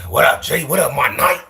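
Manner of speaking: high, punchy homie greeting; homie impression. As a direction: imitate a homie calling out hello. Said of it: "Just like the homie right there".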